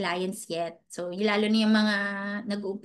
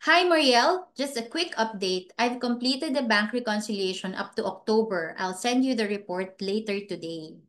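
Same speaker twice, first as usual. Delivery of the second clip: normal talking voice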